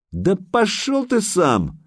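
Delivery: high, drawn-out indignant cry; angry retort. A narrator's reading. Alyona sends Sanyok packing, high indignant retort. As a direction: throw the insult back, stretched out high. Woman hurls insult back.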